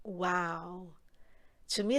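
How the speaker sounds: usual speaking voice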